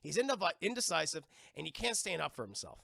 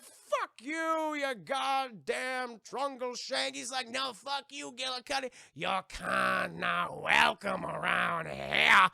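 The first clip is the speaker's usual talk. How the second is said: higher, loud, slow sneering curses; feuding insults; 5 semitones higher, 6 dB louder, slower than usual. Acted. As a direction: hurl the insult back. Feuding tree folk curse.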